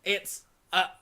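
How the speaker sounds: deadpan robot comedian bit. Retold or imitated. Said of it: "deadpan robot comedian would sort of say".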